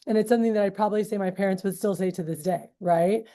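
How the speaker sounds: ordinary talk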